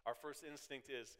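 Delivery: ordinary talk, nothing marked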